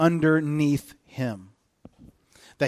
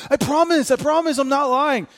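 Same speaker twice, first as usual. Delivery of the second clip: put-on much higher pleading kid voice on "I promise"; mock little kid; 10 semitones higher, 4 dB louder than usual. Preacher imitating his little kids, high pleading voice. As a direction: play a child swearing he's not lying. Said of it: "If you have little kids".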